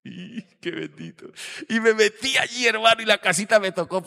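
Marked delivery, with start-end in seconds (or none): 1.7-4.0 high, loud rising exclamation; excited outburst. Real, not acted. Pastor telling the congregation about the tiny house, loud and high. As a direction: burst out in amazement.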